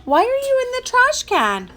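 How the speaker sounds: very high-pitched puzzled question; mom's incredulous squeak; astonished mom. Acted. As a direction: ask in high-voiced bafflement. Mom finds son in trash can.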